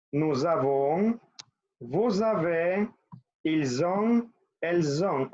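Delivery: "Nous avons" said slowly as "nuz avons", the words linked; teacher slowly modelling French liaison; liaison demo. Shown as a demonstration. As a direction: join "vous avez" into "vuz ave", drawn out. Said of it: "Aquí se une".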